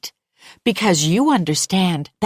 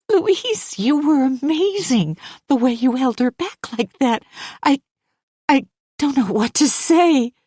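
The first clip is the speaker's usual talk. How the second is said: higher, breathless gratitude; grateful young mother; 7 semitones higher, 3 dB louder than usual. Acted. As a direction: gush thanks, still shaken. Hannah's voiced lines.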